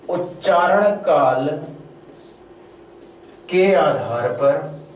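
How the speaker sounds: slow, spaced dictation of a heading. Shown as a demonstration. Teacher dictating the heading to students, slow and spaced. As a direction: dictate each word slowly so students can write. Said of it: "पहली heading बनाएंगे".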